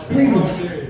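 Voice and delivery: ordinary delivery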